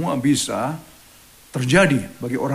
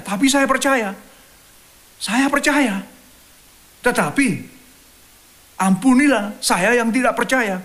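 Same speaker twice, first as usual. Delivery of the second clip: desperate plea; 7 semitones higher, 3 dB louder than usual. Retold or imitated. Preacher voices father's desperate plea.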